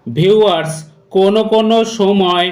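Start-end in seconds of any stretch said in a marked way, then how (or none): none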